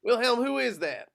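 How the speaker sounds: loud, high-pitched demand; calling down. Acted. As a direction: call it out to someone below. Calling down to the sergeant.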